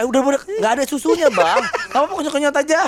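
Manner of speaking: put-on playful baby-coaxing voice; mock soothing a toddler. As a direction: hush the little one along, fast. Soothing crying toddler for milk.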